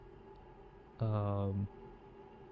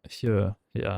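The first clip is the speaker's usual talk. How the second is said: usual speaking voice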